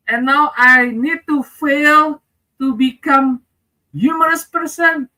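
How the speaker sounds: pleading with the mentor, whiny and high; mock crying. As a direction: wail it in hammed-up despair. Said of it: "I was crying that time".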